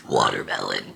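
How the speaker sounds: one long low burp shaping the word. Shown as a demonstration. Burping a word for her viewers, long low belch. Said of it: "now I'm gonna try and burp watermelon".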